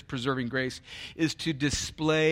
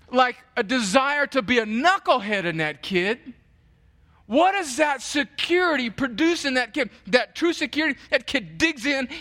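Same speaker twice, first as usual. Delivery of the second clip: louder, higher, driving sermon voice; passionate preaching; 8 semitones higher, 6 dB louder than usual. Acted. Pastor driving the point home to his flock, fervent preaching. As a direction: preach it hard to the congregation.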